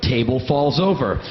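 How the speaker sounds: ordinary delivery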